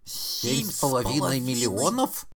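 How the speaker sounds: high, incredulous outburst; astonished rising question; exclaimed in chorus. A narrator's reading. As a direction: echo the figure in disbelief. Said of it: "воскликнули они хором".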